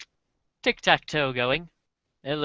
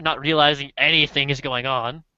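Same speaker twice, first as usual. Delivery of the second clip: ordinary talk, nothing marked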